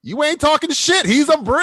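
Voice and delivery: high, loud hype; excited. Real, not acted. Host hyping a cohost's right answer, excited. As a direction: cheer him on.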